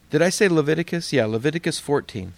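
ordinary delivery

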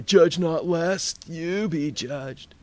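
slow, drawn-out stoned drawl; mock the weed smoker quoting the Bible; druggy stoner voice. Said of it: "Sorry about the druggy accent".

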